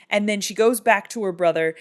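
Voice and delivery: ordinary delivery, nothing marked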